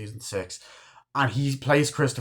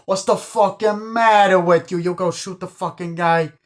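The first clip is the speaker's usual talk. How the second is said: outraged yelling; 7 semitones higher, 6 dB louder than usual. Retold or imitated. Gangster confronts friend who shot Spider, yelling in outrage. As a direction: yell each word in mock-gangster outrage.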